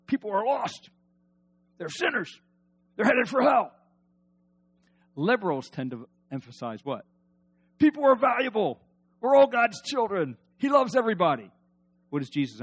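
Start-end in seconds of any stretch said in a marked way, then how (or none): 0.1-3.7 mock conservative. Mimicking conservatives' emphasis. 7.8-11.5 mock liberal. Mimicking liberals' emphasis.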